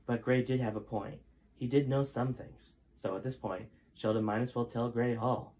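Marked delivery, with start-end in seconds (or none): none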